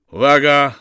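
loud, high military call; corporal's parade-ground shout; shouted command. A narrator's reading. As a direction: shout the squad to attention. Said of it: "krzyknął kapral".

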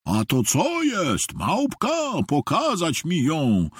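slow, put-on voice of a bossy customer; pompous shopper ordering staff about, slowly; rude fat customer. A narrator's reading. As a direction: demand it rudely, drawing the words out. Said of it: "woła niegrzecznie".

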